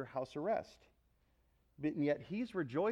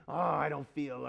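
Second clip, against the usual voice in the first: mock grumbling that slurs into wordless muttering; emotional murmuring. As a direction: murmur it like a dissatisfied complainer. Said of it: "you all know what emotional murmuring is".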